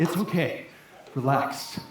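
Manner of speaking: very low, soft, unbothered voice; calm reassurance. Acted. Friend to panicking traveller, low and reassuring. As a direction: settle someone down in a deep hush.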